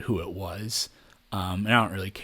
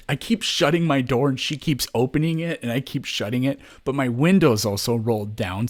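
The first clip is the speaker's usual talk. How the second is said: ordinary delivery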